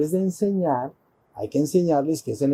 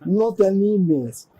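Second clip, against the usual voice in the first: mock husband refusing; 5 semitones higher than usual. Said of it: "Y entonces Shiva le dice".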